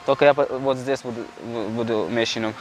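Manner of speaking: normal talking voice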